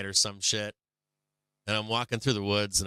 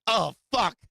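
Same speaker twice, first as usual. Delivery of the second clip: high, loud panicked yelling at himself; retold freak-out, much higher and louder; panicked outburst; 12 semitones higher, 5 dB louder than usual. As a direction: relive the panic, voice shooting up high. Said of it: "and I'm like".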